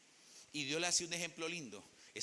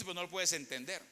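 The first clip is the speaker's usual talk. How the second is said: usual speaking voice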